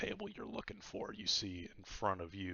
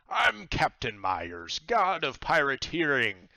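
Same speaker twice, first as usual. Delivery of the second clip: pirate god introducing himself; 7 semitones higher, 11 dB louder than usual. Acted. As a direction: proudly present yourself to the newcomer. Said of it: "and he says".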